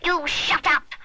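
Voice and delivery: high, loud scream; screaming creature. A narrator's reading. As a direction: scream it like a furious imp. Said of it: "it screams at him".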